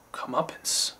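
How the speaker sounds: savoring the word in a whisper. Real, not acted. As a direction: breathe the word lovingly. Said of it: "What a great word".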